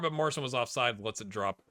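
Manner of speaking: normal talking voice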